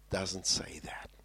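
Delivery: whispered aside. Real, not acted. Preacher hushing the congregation, whispered. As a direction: drop to a breathless whisper.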